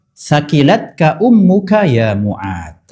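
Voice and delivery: slow, drawn-out reverent Arabic; "Sakilatka ummuka ya Mu'ad" read slowly and solemnly as scripture; slow solemn recitation. Acted. Preacher first recites the Prophet's reply, slow and solemn.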